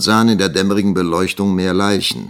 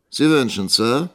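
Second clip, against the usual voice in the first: slightly raised questioning voice; polite reply; 7 semitones higher, 4 dB louder than usual. A narrator's reading. Young teacher responding to the schoolmaster's call, polite reply. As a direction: answer the summons as a subordinate. Assistant answering his superior.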